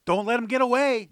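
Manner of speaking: high, loud yell while running; urgent yell. A narrator's reading. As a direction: shout the warning ahead. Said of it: "Josh yelled".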